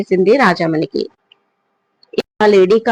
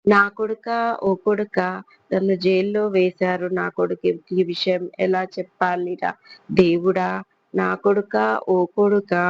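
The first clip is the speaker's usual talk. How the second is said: soft sing-song wail, softer; wailing lament; 8 dB quieter than usual. A narrator's reading. Old mother in the next cell wailing for her son, softer sing-song lament. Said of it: "ఒక ఏడుపు రాగం వినిపించింది".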